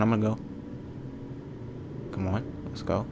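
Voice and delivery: normal talking voice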